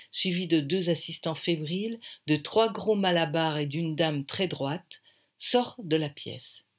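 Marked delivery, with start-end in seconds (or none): none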